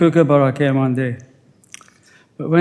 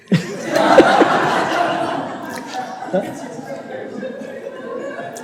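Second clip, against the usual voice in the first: breathy laugh; 9 semitones higher, 6 dB quieter than usual. Real, not acted. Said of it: "Sorry, that was deliberate".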